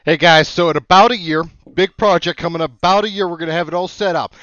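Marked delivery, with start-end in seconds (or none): none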